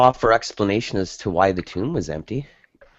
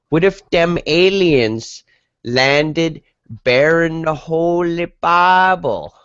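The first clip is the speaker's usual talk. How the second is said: slow, much higher mock Southern American caller; Southern US accent; 10 semitones higher, 4 dB louder, much slower than usual. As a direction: stretch it out in a hammed-up Southern US twang. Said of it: "a middle American Southern accent".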